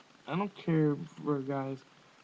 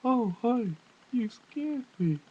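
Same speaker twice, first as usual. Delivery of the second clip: mock surprise; 8 semitones higher, 4 dB louder than usual. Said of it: "he's like".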